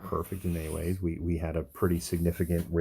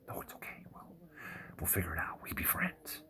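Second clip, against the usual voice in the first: hushed, softer pug promising friendship; soft whispered reassurance; 10 semitones higher, 11 dB quieter than usual. Retold or imitated. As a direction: whisper it like a gentle little dog.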